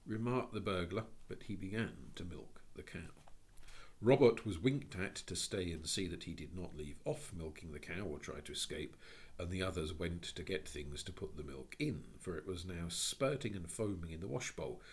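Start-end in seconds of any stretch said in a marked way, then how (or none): none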